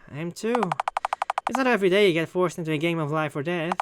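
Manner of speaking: usual speaking voice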